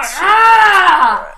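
sudden loud exasperated cry; frustrated yell. Real, not acted. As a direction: let out a fed-up shout. Frustrated outburst at recording.

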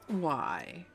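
normal talking voice

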